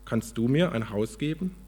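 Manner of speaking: lively, measured storybook reading; expressive storyteller with lifted key words; story narration. A narrator's reading. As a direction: read it aloud like a parable, with pauses. Reads a story aloud.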